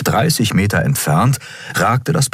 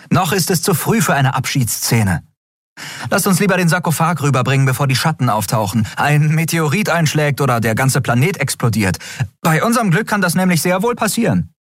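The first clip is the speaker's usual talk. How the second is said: higher, fast, wry urging; brisk Endriel; 10 semitones higher, faster than usual. A narrator's reading. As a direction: hurry them along with dry humour. Endriel urges crew on. (Endriel treibt die Crew an, schnell und höher)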